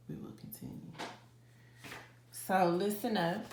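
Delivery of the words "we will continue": breathy whisper, barely there. Real, not acted. Shaken reader quietly insisting she'll continue, whispered. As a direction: say it under your breath.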